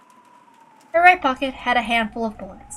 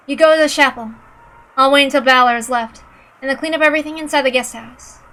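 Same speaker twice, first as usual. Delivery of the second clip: put-on higher, louder, determined young woman; Yuri, firm; 5 semitones higher, 8 dB louder than usual. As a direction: speak up, steady and resolute. Yuri's lines to her companion.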